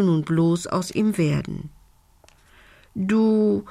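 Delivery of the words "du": stammering. A narrator's reading. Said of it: "stotterte Lumbi verlegen".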